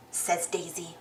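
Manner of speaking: usual speaking voice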